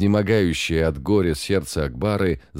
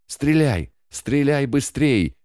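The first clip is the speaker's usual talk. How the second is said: high, slow desperate shriek; frantic scream; 8 semitones higher, slower than usual. A narrator's reading. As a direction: urge him to fire, in panic. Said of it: "кричала Гулюмкан".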